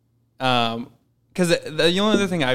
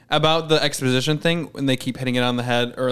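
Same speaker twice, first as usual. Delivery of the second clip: ordinary talk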